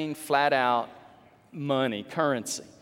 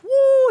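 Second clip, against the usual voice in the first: loud, high-pitched "woo"; excited whoop; 13 dB louder than usual. Acted. Preacher acts out Jesus watching the offering, excited whoop. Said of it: "He got excited".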